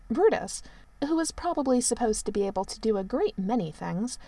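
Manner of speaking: plain reading voice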